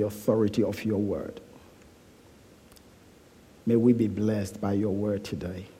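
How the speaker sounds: low, hushed prayer voice; quiet reverent prayer, fading towards the amen. Real, not acted. Leads a prayer.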